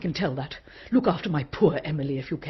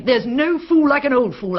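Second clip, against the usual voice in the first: raised, loud scornful quote; loud retold retort; 9 semitones higher, 5 dB louder than usual. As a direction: fling the old saying at her. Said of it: "I told her".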